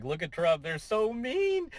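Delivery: a hint of a whiny critic; mock whiny critic. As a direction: stretch out so mean mockingly, slowly. Mocking news coverage.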